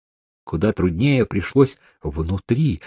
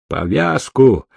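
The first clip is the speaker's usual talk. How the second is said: mild yell; 3 semitones higher than usual. A narrator's reading. Kryzhov ordering the trapped woman, yelling with restraint. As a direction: call out an order with restrained force. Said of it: "заорал Крыжов".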